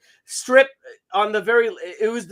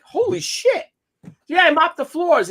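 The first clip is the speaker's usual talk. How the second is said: mock proud mother; 10 semitones higher, 3 dB louder than usual. Mother proudly reports mopping.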